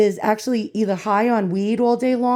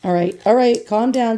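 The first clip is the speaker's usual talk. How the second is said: ordinary talk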